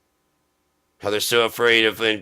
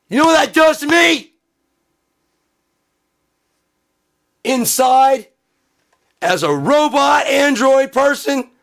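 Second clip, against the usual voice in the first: shrieking robot; 6 dB louder than usual. Acted. Billionaire android complaining to the listener, shouted robot voice. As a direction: yell it like a malfunctioning android.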